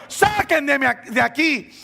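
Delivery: high, drawn-out cry for rescue; pleading re-enacted shout; acted shouting. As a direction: call slowly up out of the pit. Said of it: "ese joven gritando".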